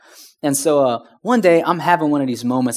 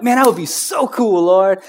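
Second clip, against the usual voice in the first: excited daydream; 4 semitones higher than usual. Retold or imitated. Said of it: "I'm like".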